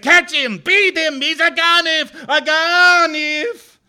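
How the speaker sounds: high, loud, eerie chant; the moon accusing. A narrator's reading. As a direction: shout the accusation like a haunting moon. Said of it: "The moon noticed me and said".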